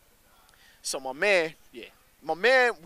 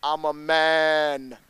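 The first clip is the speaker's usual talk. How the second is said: a hint of a spooky stranger; 5 dB louder, much slower than usual. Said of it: "this dude in darkness is like".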